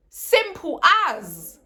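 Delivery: loud and high, "Simple as" dragged slowly; angry demand. Real, not acted. Fan demanding Jorginho leave the club, loud and angry.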